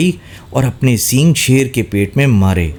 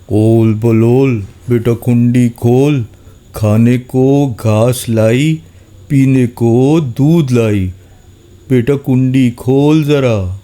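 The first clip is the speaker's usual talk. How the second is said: lion faking mother; slower than usual. A narrator's reading. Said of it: "شیر دبے پاؤں آیا اور بولا".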